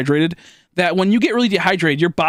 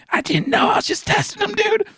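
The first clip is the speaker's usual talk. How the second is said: high, pleading, half-shouted excuse; whiny defence. Retold or imitated. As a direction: protest your innocence like a kid. Defending himself to mom.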